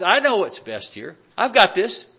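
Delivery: mock arrogant churchgoer; cocky know-it-all. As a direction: puff yourself up like you've got it all. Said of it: "Do we have that attitude sometimes".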